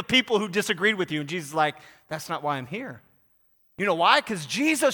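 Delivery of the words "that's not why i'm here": soft, low reply; quiet correction. Acted. Jesus to his zealous disciples, quiet correction. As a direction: say it gently low, as a calm rebuke.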